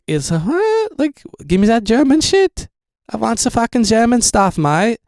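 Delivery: high, squeaky mock outrage; outraged disbelief. At the clinic told it's Moderna, squealing indignant disbelief. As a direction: demand the German one in a squealing voice.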